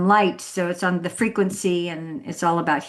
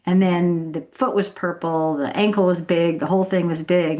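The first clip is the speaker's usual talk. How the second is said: normal talking voice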